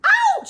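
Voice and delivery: very high, loud cry of ouch; mock ouch. Elephant hit by the falling egg, yelping. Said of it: "says the elephant".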